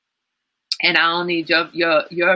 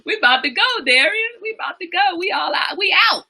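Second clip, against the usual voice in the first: high, squeaky laughing speech; laughing while talking; 11 semitones higher than usual. Real, not acted. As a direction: squeeze the words out through real laughter. Still cracking up, teasing late Darian.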